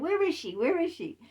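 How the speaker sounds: high, loud accusing demand; jealous demand. Retold or imitated. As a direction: hammer the question like a jealous lover. Jealous demand, retold confrontation.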